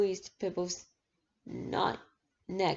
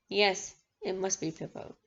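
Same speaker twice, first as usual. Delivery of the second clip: ordinary delivery, nothing marked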